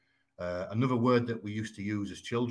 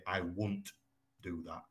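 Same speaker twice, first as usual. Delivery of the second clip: Yorkshire contraction; 2 semitones lower, 5 dB quieter than usual. Shown as a demonstration. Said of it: "in Yorkshire, we might say".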